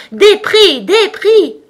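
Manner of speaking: high, loud crowd clamour; tumultuous shouting. A narrator's reading. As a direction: chant it like a rowdy mob demanding its due. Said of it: "en criant tumultueusement".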